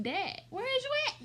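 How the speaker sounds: very high, loud, urgent yelp; mock frantic. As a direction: act a panicked call to dad. Frantic call to daddy.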